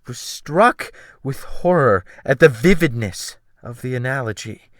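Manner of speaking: ordinary delivery, nothing marked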